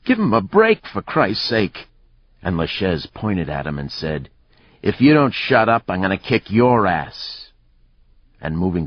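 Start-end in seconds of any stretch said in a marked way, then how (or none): 0.0-1.9 high-pitched shouted protest; old man's yell. A narrator's reading. Old man protesting a beating, yelling. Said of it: "the old man yelled". 4.8-7.5 mildly angry, raised pitch; angry threat. A narrator's reading. Fighter threatening an old man who intervened, angry.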